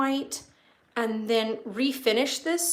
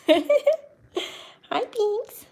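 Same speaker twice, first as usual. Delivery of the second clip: high, louder sing-song hello; cooing at the kitten; 11 semitones higher, 5 dB louder than usual. Real, not acted. Greeting Binks in a high coo. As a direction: pitch right up for the kitten.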